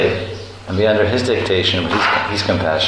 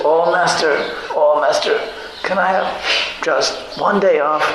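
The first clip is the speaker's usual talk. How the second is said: higher, slow pleading to the master; slave begging; 8 semitones higher, slower than usual. Acted. The slave begs his master for a day off, high and slow. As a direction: whine for a day off. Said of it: "The slave says".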